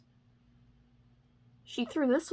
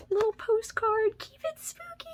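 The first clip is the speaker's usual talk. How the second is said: cutesy squeal; 12 semitones higher than usual. Real, not acted. Gushing over a cute postcard.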